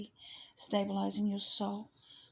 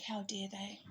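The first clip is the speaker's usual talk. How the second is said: whispered, much softer outrage; hushed indignation; 2 semitones lower, 12 dB quieter than usual. Retold or imitated. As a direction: hiss it under your breath. Retelling anger at slander.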